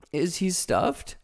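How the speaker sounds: be amazed; the lion surprised about the Scarecrow. A narrator's reading. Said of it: "asked the lion in surprise".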